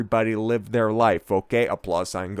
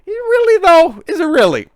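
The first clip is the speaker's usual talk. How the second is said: much higher, louder mock-incredulous squeal; sarcastic squeal; 10 dB louder than usual. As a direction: shriek the doubt in a sarcastic falsetto. Sarcastic aside on Bud Light.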